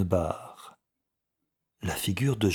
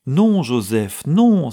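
loud, drawn-out denial, rising high; protesting cry; 6 semitones higher, 8 dB louder, slower than usual. A narrator's reading. Old clerk denying his brother's accusation, protesting cry. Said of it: "s'écria Samson Wilmot".

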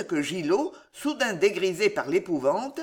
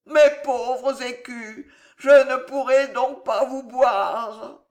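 put-on slow drawn-out drunken lament; whining drunkard; 5 semitones higher, 4 dB louder, slower than usual. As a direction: wail tipsily over the money. Narrator plays whining drunk nephew.

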